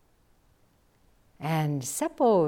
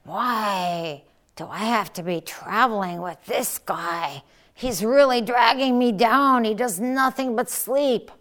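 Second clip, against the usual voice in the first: muttered complaint; 4 semitones higher, 6 dB louder than usual. Acted. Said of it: "muttered to himself".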